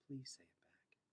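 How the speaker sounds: soft whispered plea. A narrator's reading.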